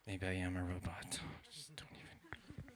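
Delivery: very soft, low, whispery self-doubt; defeated murmur. Acted. Comic doubting himself after failing the captcha, defeated whisper. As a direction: sink into a hushed, beaten aside.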